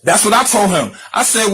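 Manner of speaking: ordinary talk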